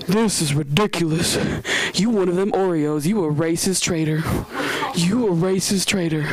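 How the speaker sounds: low-pitched mock indignation; outraged customer. Customer accuses the manager as she leaves, mock outrage. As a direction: imitate an affronted customer, lowering the pitch.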